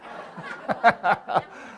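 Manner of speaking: breathy, voiceless burst of laughter; laughing. Real, not acted. Dreamer tells of landing on the floor, laughing. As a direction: laugh at your own punchline.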